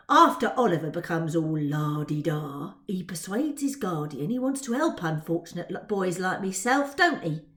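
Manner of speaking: a hint of cockney scorn; mock Oliver's airs, cockney; lightly voiced Dodger. Dodger scoffing at Oliver to Twill, a hint of cockney.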